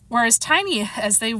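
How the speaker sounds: ordinary delivery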